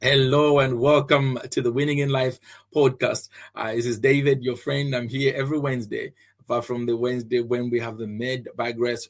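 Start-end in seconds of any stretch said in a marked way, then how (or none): none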